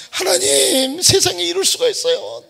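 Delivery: high tearful plea; crying prayer. Retold or imitated. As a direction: plead with God through tears. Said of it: "산에 올라가갖고 매일". (높은 목소리로 울먹이며 하소연)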